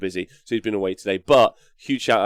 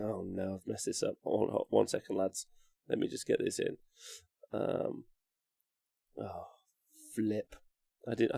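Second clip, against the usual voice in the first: muttering; 3 semitones lower, 14 dB quieter than usual. Real, not acted. Mutters while fixing a mistake.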